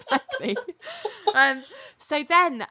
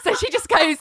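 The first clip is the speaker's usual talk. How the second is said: usual speaking voice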